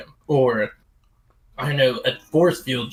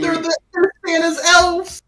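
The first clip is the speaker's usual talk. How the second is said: squeaky high voice. Pretend.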